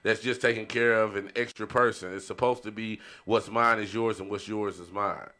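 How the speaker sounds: ordinary delivery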